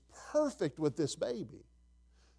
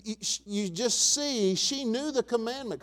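ordinary delivery

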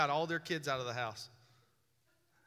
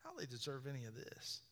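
1 resentful muttered thought; 3 semitones lower, 17 dB quieter than usual. Retold or imitated. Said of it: "and think".

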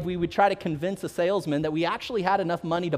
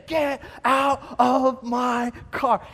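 slow, drawn-out warning, only a bit raised; milder angry yell; 5 semitones higher, much slower than usual. Retold or imitated. Owner calling out again from a distance, slow mock yell. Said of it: "we hear it again".

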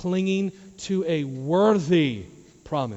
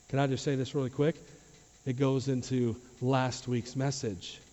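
ordinary talk, nothing marked

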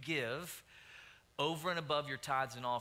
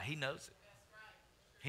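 slow, soft, drawn-out he knows it; hushed repeat; 2 semitones higher, 6 dB quieter, much slower than usual. Real, not acted. Pastor reminding church God knows, slow hushed emphasis. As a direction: drop to a near hush, lingering. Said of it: "repeats softly vs loud".

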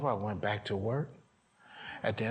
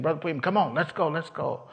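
loud, high, rousing call; pitched-up, pushy cheering on; urgent summons; 7 semitones higher, 6 dB louder than usual. Retold or imitated. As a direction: imitate a leader hustling someone up front. Imitates woman summoning him to lead.

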